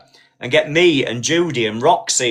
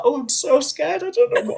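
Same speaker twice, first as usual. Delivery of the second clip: hammed-up frightened damsel, pitched way up; fake-fearful squeak of a fluttering wife; mock scared. Sylvia plays scared to blag petrol, high mock fear. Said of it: "she was like".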